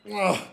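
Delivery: grunting while lifting; strained grunt from the lift; effort grunt. Real, not acted. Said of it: "Sorry, I'm grunting".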